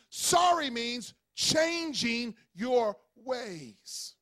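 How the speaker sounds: slow emphatic statement. Acted. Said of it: "preaching emphasis".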